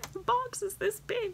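high, soft tearful squeak; crying. Real, not acted. Showing viewers the gift box, crying. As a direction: squeeze it out softly through real tears.

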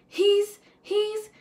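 sputtering astonishment; amazed declaration. A narrator's reading. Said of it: "declared Hammerswine to Hoggers".

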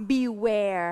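beware stretched very slow and low; slow model word. Shown as a demonstration. Teacher introducing beware slowly to the class. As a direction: drag out beware slowly so pupils hear it. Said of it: "คือคำว่า".

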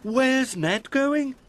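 very high, slow, puzzled question; wondering little digger voice; Jack's character voice. A narrator's reading. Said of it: "asked Jack".

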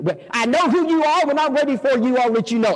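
high-pitched mock tirade; hammed-up angry rebuff in character; irate neighbor. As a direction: cut the visitor off indignantly.

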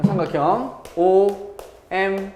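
"삼각형 오엠엔" slowly, letters held apart. Shown as a demonstration. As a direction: dictate "오엠엔" letter by letter at half pace. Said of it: "요렇게 읽으시면 돼요". ("삼각형 오엠엔"을 느리게 끊어 읽기)

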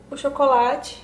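usual speaking voice

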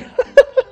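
shrill laugh. Real, not acted. Shrill reaction.

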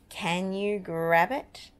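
plain reading voice

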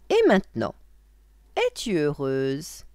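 loud witch's question, slowing at the end. Acted. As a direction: press the child as an expectant witch. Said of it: "lui redemanda la sorcière".

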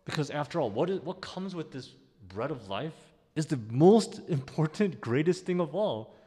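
high, loud, building to a fervent peak; rising climax. Real, not acted. Preacher swelling toward eternal life before the congregation. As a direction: build it up to full force.

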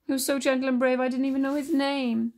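hammed-up weepy voice; acted sobbing. A narrator's reading. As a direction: wail it as a grieving princess. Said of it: "she sobbed to Raja".

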